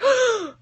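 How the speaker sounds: high offended intake of breath; sharp gasp. Shown as a demonstration. Author shows Death's sharp offended gasp. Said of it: "he, like, inhales".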